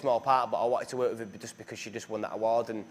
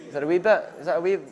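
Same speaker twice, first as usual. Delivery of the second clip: a quick, louder stab at a Scottish accent; 6 semitones higher, 8 dB louder, faster than usual. Shown as a demonstration. Asked to show an accent, tries a Scottish accent. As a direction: try out a Scottish accent, half joking. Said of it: "Try and do a Scottish accent like that".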